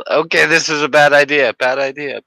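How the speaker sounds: high, loud mock panic; hammed-up alarm at a bad idea.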